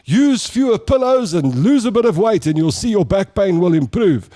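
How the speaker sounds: a hint of a brisk doctor quizzing a caller; mock radio doctor. Radio professor questioning the mother, lightly voiced brisk doctor. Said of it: "And he'd say".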